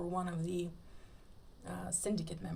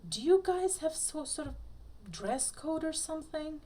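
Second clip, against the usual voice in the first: higher voiced Mulder, mocking; teasing question; 9 semitones higher than usual. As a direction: poke fun with a raised voice.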